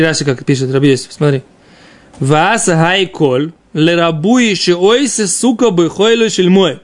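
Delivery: ordinary talk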